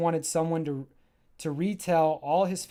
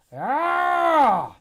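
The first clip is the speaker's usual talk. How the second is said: much higher, loud startled cry; acted scream; 11 semitones higher, 9 dB louder than usual. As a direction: scream as the ground shakes. Earthquake hits the scene.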